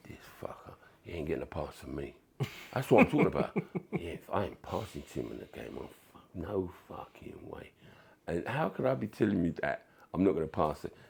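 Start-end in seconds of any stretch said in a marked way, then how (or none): 0.1-2.1 very soft, low, quick muttered vow; voicing your own sulky thoughts; inner grumbling. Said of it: "My head was going like this". 3.9-7.7 very soft muttering to yourself; acted-out resentful thought under the breath; inner grumbling. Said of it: "My head was going like this".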